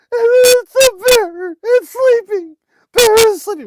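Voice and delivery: put-on high, loud wailing child's voice, drawn out; imitating crying child. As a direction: wail like a little boy sobbing over the bear. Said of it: "Why are you crying?".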